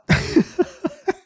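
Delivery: shrill howl of laughter; high shrieking laugh. Real, not acted. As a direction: squeal with laughter. Laughing at game.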